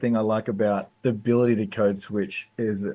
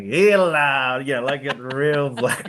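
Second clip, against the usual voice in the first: switch to blackfella voice; 4 semitones higher, slower than usual. Retold or imitated. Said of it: "And then, like".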